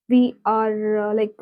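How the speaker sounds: usual speaking voice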